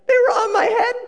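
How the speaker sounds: very high, loud sheepish squeal; wife's realisation. Acted. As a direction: squeal it as the embarrassing discovery. Wife realises the sunglasses were there.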